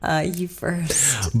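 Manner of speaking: whispered, slow and low; breathy whispered aside. Real, not acted. Shy aside before attempting accents.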